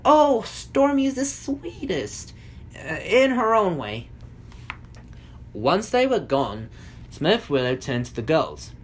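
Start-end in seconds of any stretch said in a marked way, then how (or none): 0.0-4.1 high, slow, smiling character voice; drawn-out, high, affectionate cooing. A narrator's reading. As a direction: gush it sweetly with a smile, pitched up. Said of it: "Smurf Blossom smiled".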